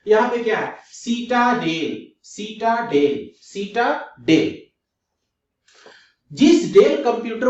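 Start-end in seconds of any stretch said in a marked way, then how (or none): 0.9-4.6 citadel slowly, split as सीटा, डेल; slow, stretched citadel in separate parts; broken by syllable. Shown as a demonstration. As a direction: break citadel apart with a gap before डेल. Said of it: "आप syllable में तोड़िए".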